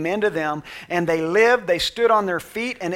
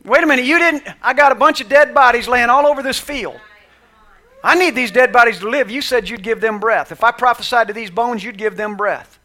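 exasperated Ezekiel; 3 semitones higher, 6 dB louder than usual. Acted. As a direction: protest like a frustrated prophet. Voicing Ezekiel complaining to God.

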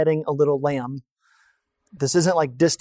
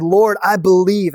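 earnest confession; 4 semitones higher, 6 dB louder than usual. A narrator's reading. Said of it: "and he worshiped him".

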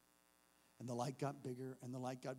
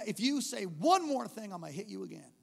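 loud, raised warning; stern threat; 4 semitones higher, 8 dB louder than usual. Retold or imitated. Warning the slapped kid, stern and loud. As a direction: lay down the law to him.

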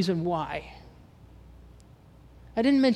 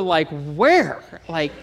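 ordinary talk

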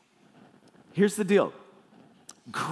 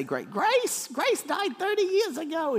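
high, hammed-up movie character voice; movie character impression; 13 semitones higher, slower than usual. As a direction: imitate a comic film character, pitched way up. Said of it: "If you ever watched National Lampoon's Christmas Vacation".

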